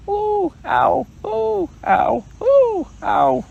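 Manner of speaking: high squeaky ooh ow; bouncing ooh ow. Acted. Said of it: "as he was saying".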